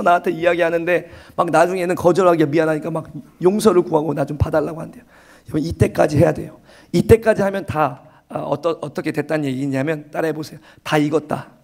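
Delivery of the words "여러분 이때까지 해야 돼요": normal talking voice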